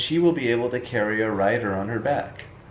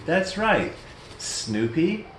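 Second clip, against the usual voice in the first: ordinary delivery, nothing marked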